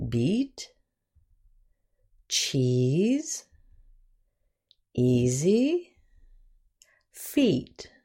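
beat, cheese, easy, feet, each alone with a long "ee"; long E examples. Shown as a demonstration. Teacher giving words with long E, careful modelling. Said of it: "Some words".

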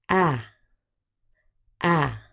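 "ah" as the short A in apple. Shown as a demonstration. Teacher starting the short vowel sounds, short A. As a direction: sound out "ah" twice, short and separate. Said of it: "let's do the short vowel sounds".

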